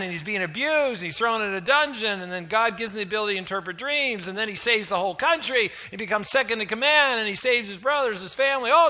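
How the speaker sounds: usual speaking voice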